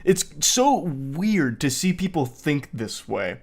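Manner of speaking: usual speaking voice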